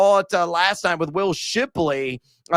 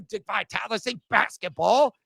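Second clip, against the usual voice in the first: usual speaking voice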